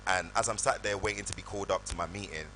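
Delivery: usual speaking voice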